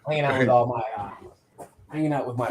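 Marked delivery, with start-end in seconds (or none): none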